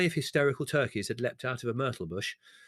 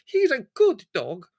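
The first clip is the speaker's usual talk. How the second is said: high, loud, slow tearful cry; tearful shriek; 11 dB louder, slower than usual. Acted. The tearful maid defends the dog: high, slow, loud tearful cry. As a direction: cry it out through tears. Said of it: "eyes swimming with tears".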